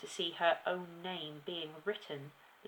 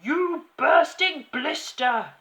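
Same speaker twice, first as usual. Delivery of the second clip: slow, high, very loud insult; drawn-out furious bellow; yelling; 10 semitones higher, 15 dB louder, slower than usual. A narrator's reading. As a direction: yell it with relish as the tyrant. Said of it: "yelled the Trunchbull".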